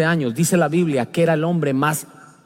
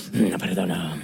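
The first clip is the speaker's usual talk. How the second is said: low, soft, unvoiced mutter of refusal; imitating a stubborn unforgiving believer, low and hushed; bitter grudge voice; 11 semitones lower, 3 dB quieter, slower than usual. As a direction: mumble it like someone poisoned by grudges. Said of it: "y estoy así".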